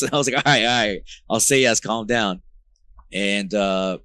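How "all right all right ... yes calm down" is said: mock exasperated calm down. Laughing at bandmates' eager yes.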